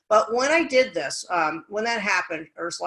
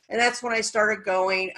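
usual speaking voice

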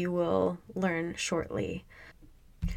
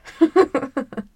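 embarrassed laugh; 6 semitones higher, 7 dB louder than usual. Real, not acted. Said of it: "My voice just cracked".

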